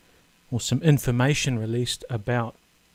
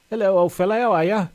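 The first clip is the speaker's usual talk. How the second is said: lightly voiced old man, higher; a hint of a creaky old codger greeting; 8 semitones higher, 4 dB louder, faster than usual. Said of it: "I like to do that in my old man voice".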